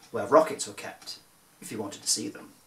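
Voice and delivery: ordinary delivery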